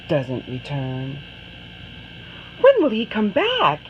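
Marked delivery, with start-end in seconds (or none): 2.6-3.8 higher, eager question; Anna pleads with her mother; longing child. Acted. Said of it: "she asks her mother".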